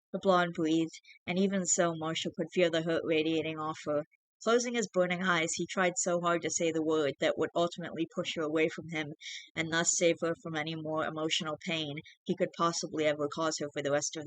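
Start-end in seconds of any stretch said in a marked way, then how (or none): none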